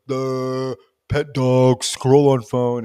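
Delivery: slow, sluggish mock-robot drone; a lightly voiced lazy body; dull body voice. Body speaks its dull wants.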